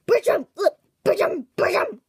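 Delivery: loud, high urgent call. Acted. Warning Bertram the boss is coming, calling loudly. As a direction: shout his name to warn him.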